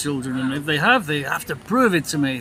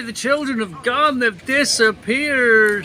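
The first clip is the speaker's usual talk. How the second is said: mock ghostly wail; 8 semitones higher, 4 dB louder, slower than usual. Mock spooky wail about children.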